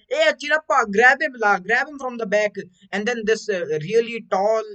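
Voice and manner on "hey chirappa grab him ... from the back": loud, high South Indian shout; south indian accent. Retold or imitated. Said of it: "he's gonna go like".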